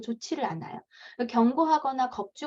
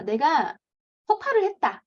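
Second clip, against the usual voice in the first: normal talking voice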